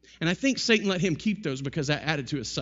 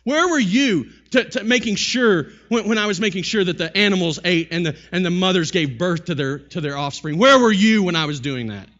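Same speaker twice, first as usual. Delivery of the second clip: thundering divine rebuke; 4 semitones higher, 10 dB louder than usual. Acted. God questioning Job about tending creation, thundering. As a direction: build to a booming demand. Resumes God's confrontation of Job.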